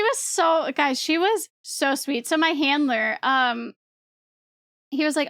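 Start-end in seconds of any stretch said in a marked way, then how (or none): none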